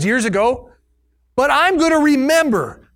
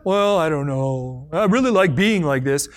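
mopey whiner; 8 semitones lower than usual. Retold or imitated. Voicing someone not wanting healing.

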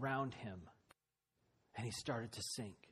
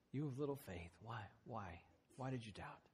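much softer tender question; soft gentle rebuke; 3 semitones lower, 9 dB quieter than usual. Acted. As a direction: say it almost in a hush, lovingly. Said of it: "He just says".

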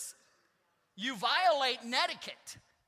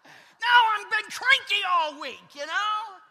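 much louder, higher, slowed confession; emphatic preaching; 7 semitones higher, 10 dB louder, slower than usual. Real, not acted. Pastor admitting to the congregation he was cranky, loud and emphatic. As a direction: belt it out to the back row.

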